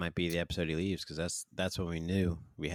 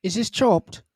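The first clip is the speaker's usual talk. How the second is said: much higher, louder mock gasp of a fan; excited onlooker; 14 semitones higher, 9 dB louder, slower than usual. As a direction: blurt it out like a starstruck passer-by. Mocking bystanders at Whole Foods.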